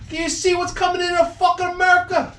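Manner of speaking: put-on very high, incredulous old Italian; outraged old Italian. Old Italian guy complaining to his friend, very high and outraged. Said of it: "he goes".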